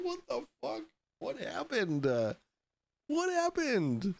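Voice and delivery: high, stunned and laughing; shocked disbelief. Retold or imitated. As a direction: squeak it out in confusion. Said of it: "I was like".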